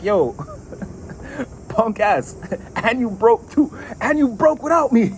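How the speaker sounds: high mock taunt, laughing through it. Querent mocking the Knight of Swords, high taunting laugh. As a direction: rub it in their face, giggling. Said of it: "But you're like".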